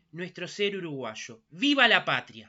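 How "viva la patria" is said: loud rallying cry to the crowd; booming balcony speech. Real, not acted. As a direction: shout it out to the square. Closing patriotic cheer at ceremony.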